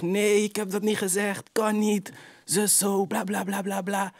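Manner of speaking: high, slow whiny mock voice of the boy denying it; mocking the snitch. Mimicking the boy on the stand, high mock whine. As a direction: squeak out his denial like a scared snitch. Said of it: "Die boy zegt".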